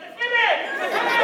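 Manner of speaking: high-pitched mock yelling; loud, hammed-up exasperation; exasperated uncle shout. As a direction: yell it like an incredulous uncle. Uncle keeps shouting his word.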